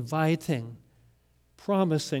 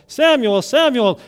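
calling out; 6 semitones higher, 8 dB louder than usual. Acted. God calls second time.

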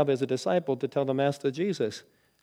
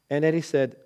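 normal talking voice

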